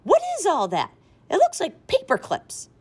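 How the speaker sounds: horrified mother; mother discovering paper clips, shrill with shock; alarmed. A narrator's reading.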